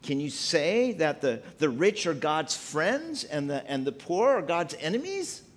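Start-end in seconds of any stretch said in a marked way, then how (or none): none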